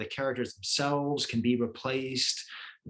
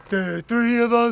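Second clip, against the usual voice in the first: Yellow Guy voice; 10 semitones higher, 9 dB louder than usual. Retold or imitated. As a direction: sing it like a simple-minded puppet. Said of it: "Yellow Guy's like".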